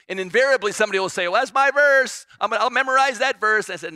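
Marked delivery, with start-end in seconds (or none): 1.3-3.7 much higher and louder, gleeful claim; mock eager child, fast and loud; eager kid. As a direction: blurt it out like a keen kid who found a loophole. Kid claiming the shortest verse.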